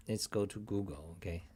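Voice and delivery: ordinary delivery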